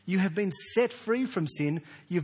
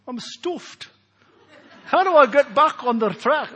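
put-on 1 failed English accent; 9 semitones higher, 11 dB louder than usual. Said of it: "I can't do the accent".

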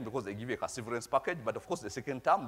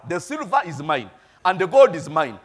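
the silver is mine and the gold is mine, declared loud and ringing; loud preached promise; 3 semitones higher, 11 dB louder than usual. Acted. Pastor assuring the crying exiles, loud triumphant declaration.

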